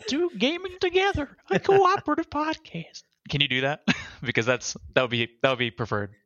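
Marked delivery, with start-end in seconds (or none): 0.1-3.0 put-on high, wavering old lady voice. Shown as a demonstration. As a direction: croak it out like a little old granny. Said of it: "can you do, like, an old lady voice?".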